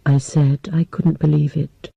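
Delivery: usual speaking voice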